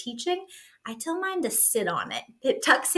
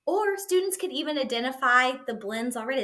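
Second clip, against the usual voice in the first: ordinary talk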